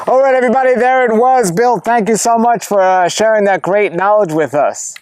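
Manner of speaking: high, projected presenter voice; video host sign-off. Real, not acted. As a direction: wrap up the show for the viewers, pitched well up. Host's sign-off to viewers.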